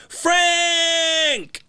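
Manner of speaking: long, high drawn-out call of the name. Retold or imitated. Calling a friend who won't answer, drawn-out high call. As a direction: stretch the name out like calling across a yard.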